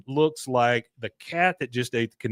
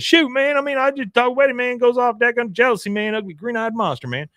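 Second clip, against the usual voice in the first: Patch impression; 11 semitones higher, faster than usual. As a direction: whine it like Patch. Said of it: "Pat says".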